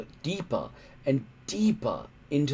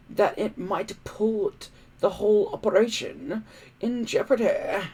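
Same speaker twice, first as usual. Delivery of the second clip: higher, oily fake-friendly voice; smooth smiling villain; 8 semitones higher than usual. Alvin wishing the departing hooligans luck, smooth and smiling. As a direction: sweet-talk them while you plot. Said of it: "smiling smoothly and easily".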